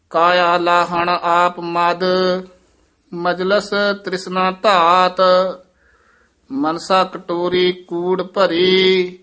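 measured chanting recitation of Gurbani; scripture recitation. Shown as a demonstration. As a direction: recite each phrase slowly and evenly.

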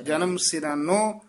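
ordinary delivery

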